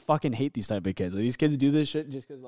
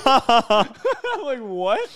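laughing disbelief; 11 semitones higher, 7 dB louder, much slower than usual. Real, not acted. Laughing while retelling awkward moment.

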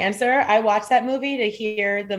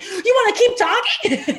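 high laughing disbelief; mock incredulous; 12 semitones higher, 4 dB louder than usual. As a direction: scoff through a laugh. Retelling crying argument.

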